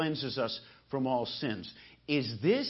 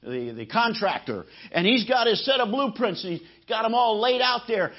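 usual speaking voice

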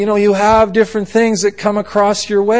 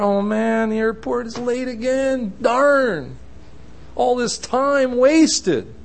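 high, drawn-out whiny complaint; mock grumbling; 7 semitones higher, 5 dB quieter, slower than usual. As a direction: lament wasted time in hammed-up dismay. Imagined Jesus grumbling at delay.

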